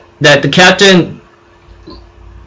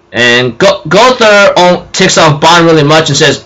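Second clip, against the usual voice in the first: ordinary talk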